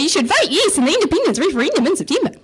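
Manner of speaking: high, wildly see-sawing singsong; high-pitched mock sing-song plea; exaggerated accent. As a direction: send up your own New Zealand accent in a high bobbing voice. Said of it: "who's gonna take you seriously if you say".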